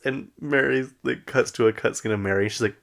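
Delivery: usual speaking voice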